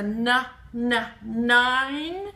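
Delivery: "na, na, nine" in separate beats; first sound repeated. Shown as a demonstration. Teacher stressing the starting n, "na, na, nine". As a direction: tap the n twice before a held "nine". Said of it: "The na is at the beginning".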